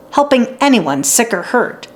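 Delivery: usual speaking voice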